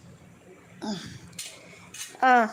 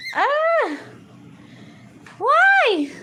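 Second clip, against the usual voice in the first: high, louder cry of protest; startled exclamation; 10 semitones higher, 4 dB louder than usual. Real, not acted. Speaker reacts to a pet that won't come, startled. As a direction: exclaim high, as if taken aback.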